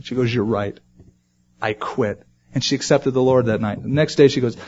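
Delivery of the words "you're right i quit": retold tearful surrender. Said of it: "She just started crying".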